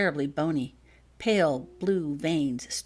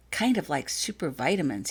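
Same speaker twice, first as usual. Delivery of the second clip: ordinary delivery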